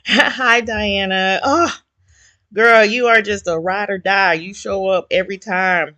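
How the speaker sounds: bright, high happy hello; light up greeting a friend; excited greeting. Real, not acted. Greeting a regular viewer.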